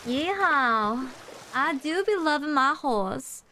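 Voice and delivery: high, slow Southern drawl; hammed-up Southern cowgirl accent; Southern horse girl. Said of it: "I am a Southern horse girl".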